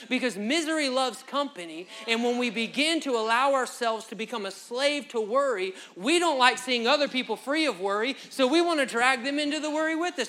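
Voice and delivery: loud, high preaching build; full-force preaching. Acted. Preacher drives home worry to the congregation, loud. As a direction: drive the point home to the room.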